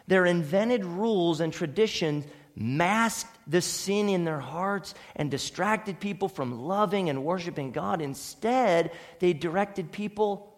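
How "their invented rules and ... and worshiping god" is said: normal talking voice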